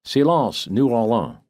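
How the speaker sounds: high, called-out French warning; hollered warning. A narrator's reading. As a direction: holler it down the street to the crew. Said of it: "hollered the same warning in French".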